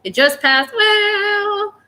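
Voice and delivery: grandfather's voice. Retold or imitated. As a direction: imitate grandpa, stretching the last word up high. Repeating what the grandfather shows her.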